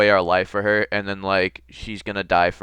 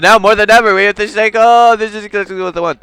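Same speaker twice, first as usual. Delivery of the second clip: raised, louder earnest urging; dramatic push; 10 semitones higher, 9 dB louder than usual. Acted. Protagonist urging Sayaka, louder. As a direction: press the point urgently.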